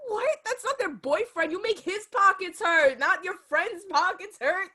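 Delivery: high-pitched, incredulous protest; playful indignation. Real, not acted. Speaker correcting co-host about whose pockets to hurt, playful indignation. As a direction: lay down the correction, stretching it out. Objects indignantly to co-host's joke.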